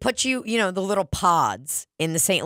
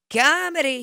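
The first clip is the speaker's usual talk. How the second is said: Mark Normand cadence; 6 semitones higher than usual. Retold or imitated. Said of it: "he'll go".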